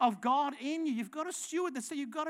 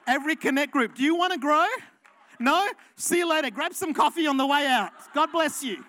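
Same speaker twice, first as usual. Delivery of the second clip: louder, brisk, mock dismissal; mock blunt pastor; 9 dB louder, faster than usual. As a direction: play a pastor shooing people out. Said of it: "Can you imagine if we started".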